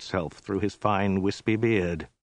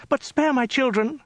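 very high falsetto woman's plea; squeaky, terrified begging; frightened pleading. A narrator's reading. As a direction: beg in a high, fearful voice. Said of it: "stammered the woman".

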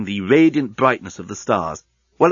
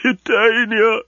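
much higher, sobbing call of her name; 11 semitones higher, 4 dB louder, slower than usual. Acted. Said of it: "he was sobbing".

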